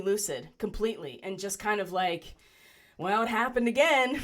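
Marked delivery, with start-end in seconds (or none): 3.0-4.2 higher and louder, on the edge of a laugh; wry amused. Retold or imitated. Telling friends about fainting again, wry and amused. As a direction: toss it off like a shrugging joke.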